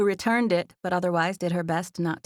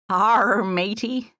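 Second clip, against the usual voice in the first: loud, higher pirate arr; playful pirate; 4 semitones higher, 5 dB louder, much slower than usual. A narrator's reading. Joining her friend's joke, mock pirate voice. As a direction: play the pirate with a big opening arr. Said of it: "I totally meant it in a pirate booty way".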